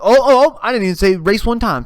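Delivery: high, loud imitation of indignant denial; mock indignation. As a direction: jump in loudly, acting falsely accused. Acting his indignant self-defence.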